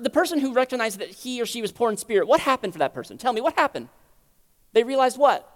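ordinary delivery

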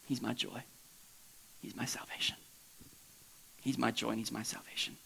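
very soft, low, whispery devotion; quiet, intimate praise with long pauses; hushed reassurance. Acted. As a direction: murmur it to your own soul. Self-soothing praise after inner turmoil.

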